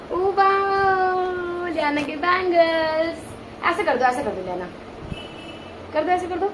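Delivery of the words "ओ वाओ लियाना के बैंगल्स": long drawn-out, higher wow; cooing admiration. Real, not acted. Admiring toddler's bangles.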